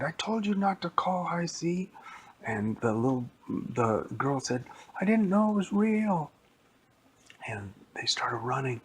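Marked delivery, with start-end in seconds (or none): 0.0-1.9 scolding. Acted. Boy blaming others in fright. 5.0-6.3 put-on high, loud panicked girl's cry; frightened girl. Girl fleeing the monster.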